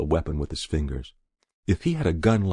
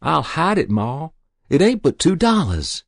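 put-on high, eager boyish coaxing; pleading; 10 semitones higher, 5 dB louder than usual. A narrator's reading. Boy talking Ma into the money, pleading. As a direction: reassure her urgently.

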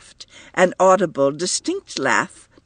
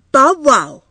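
put-on high, loud little child calling it out; a toddler insisting on her word; 11 semitones higher, 8 dB louder than usual. A narrator's reading. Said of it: "repeated Edie".

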